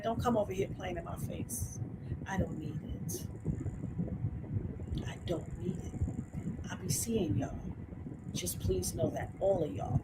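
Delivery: softly warning the chat trolls; quiet warning. Real, not acted. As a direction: warn them softly.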